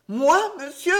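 high, loud disbelieving outcry; accused boy protests to the headmaster, shocked and loud; shocked cry. A narrator's reading. A high startled cry of innocence. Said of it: "s'écria Hardy".

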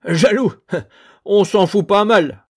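high, loud scornful laugh; mocking laughter. A narrator's reading. Les hommes rigolent, moqueurs. (rire moqueur, aigu et fort)

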